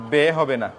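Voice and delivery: normal talking voice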